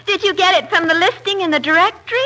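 ordinary delivery

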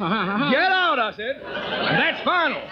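high-pitched angry Southern outburst; furious dismissal. Acted. Colonel ending the impostor's pleading, furious dismissal. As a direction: throw him out, voice leaping up.

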